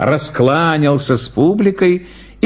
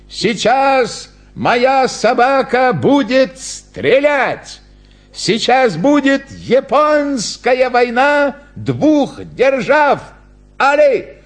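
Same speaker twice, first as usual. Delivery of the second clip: high, slow grand showman's announcement; clown announcer; 10 semitones higher, much slower than usual. Acted. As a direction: build it up for the crowd, drawn out. (торжественно объявляет клоун, высоко и протяжно)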